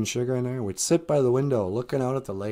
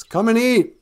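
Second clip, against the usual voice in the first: high, loud call to the birds; 7 semitones higher, 5 dB louder, slower than usual. Retold or imitated. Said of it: "And he goes".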